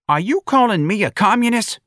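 high, loud indignant question; officer's offended, high-pitched demand; affronted policeman. A narrator's reading. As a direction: challenge him loudly. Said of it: "the policeman asked the old man".

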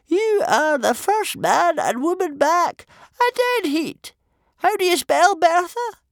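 very high, slow, loud official; gloomy chief judge. A narrator's reading. Chief judge recording the losers' names, high gloomy voice. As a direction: announce it in a high-pitched old official's voice.

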